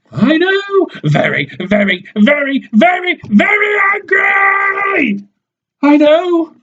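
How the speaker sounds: mocking shriek. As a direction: wind her mockery up into a shout. Madam mocks angry Big Nose.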